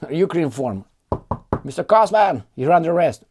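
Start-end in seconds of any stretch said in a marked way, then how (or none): 1.6-3.2 high, loud mock cop announcement; mock arrest. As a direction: bark it like a police arrest. Joke arrest as he leaves.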